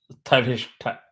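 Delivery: "sthavishtha" with a strongly aspirated, forceful tha; very hard tha. Shown as a demonstration. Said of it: "tha has to come out very hard".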